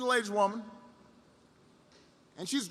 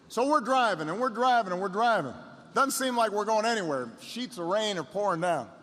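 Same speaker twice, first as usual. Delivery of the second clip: ordinary talk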